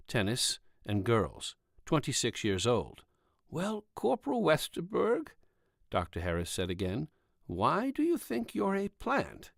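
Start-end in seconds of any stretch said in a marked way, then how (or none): 3.5-5.3 doctor. Acted. Doctor voiced higher. 7.4-9.5 doctor. Acted. Doctor asks again, slowly.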